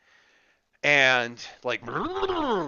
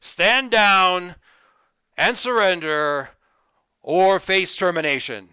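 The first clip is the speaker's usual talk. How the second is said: bullhorn voice; 5 semitones higher, 6 dB louder than usual. Acted. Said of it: "bullhorn voice from out on the school property says".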